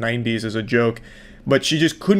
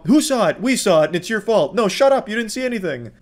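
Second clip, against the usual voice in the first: mock back-and-forth argument; 7 semitones higher than usual. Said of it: "You know".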